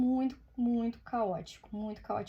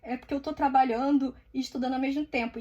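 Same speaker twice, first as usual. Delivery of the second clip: choro imitado, suplicante; 3 semitones higher, 5 dB louder, faster than usual. Retold or imitated. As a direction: act out begging through tears, hammed-up. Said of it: "Aí eu comecei a chorar". (súplica chorosa, imitando o próprio desespero)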